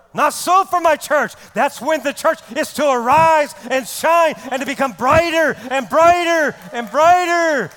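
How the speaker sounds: high, loud rallying sermon; full-force preaching. Acted. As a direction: rouse the church, stretching the repeated word.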